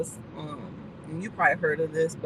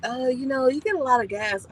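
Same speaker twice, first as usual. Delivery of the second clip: lightly voiced, higher bookkeeper remark; imitated bookkeeper; 6 semitones higher, 4 dB louder than usual. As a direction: mildly mimic a querying bookkeeper. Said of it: "my bookkeeper's gonna be like".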